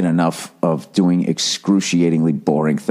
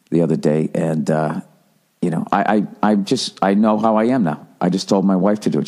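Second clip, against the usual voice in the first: ordinary talk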